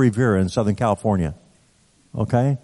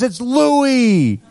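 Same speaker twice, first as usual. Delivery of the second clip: high and eager; eager reveal; 10 semitones higher than usual. Retold or imitated. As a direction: say your name hoping he remembers you. Inmate reveals himself eagerly.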